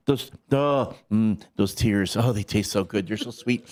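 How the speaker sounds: low, relishing pretend tasting; mock savoring. Bears fan pretending to taste rivals' tears, gloatingly savoring.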